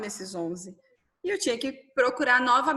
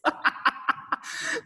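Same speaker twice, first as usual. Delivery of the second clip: riso choroso; 13 semitones higher than usual. Real, not acted. Riso choroso após a lembrança.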